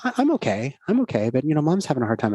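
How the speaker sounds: lightly voiced little daughter; daughter's voice. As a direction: voice the little girl gently. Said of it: "And she said".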